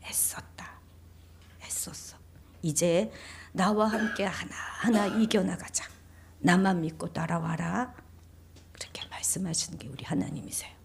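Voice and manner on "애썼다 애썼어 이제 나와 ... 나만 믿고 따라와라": very soft, hushed reassurance; gentle, quiet words of acceptance; tender, unreproaching God. Acted. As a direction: comfort a weary child, almost whispering at first. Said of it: "한마디도 책망하지 않으세요".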